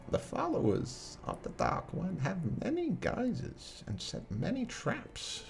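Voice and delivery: put-on a wise old witch, unhurried; old lady Morgan Freeman. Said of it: "I'm doing old lady Morgan Freeman".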